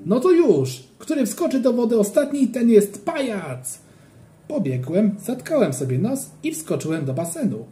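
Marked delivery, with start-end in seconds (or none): 0.1-3.8 high, loud dare, the last words slowed; playful shout. A narrator's reading. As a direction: yell the challenge again up high. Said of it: "krzyknąłem".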